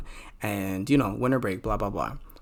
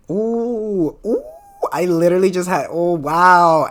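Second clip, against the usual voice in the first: real gasp, then ooh and wow burst out higher and louder; startled gasp; 7 semitones higher, 10 dB louder, slower than usual. Real, not acted. Vlogger hit by sudden deja vu, gasping loudly in surprise. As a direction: caught off guard by real deja vu, shout the wonder.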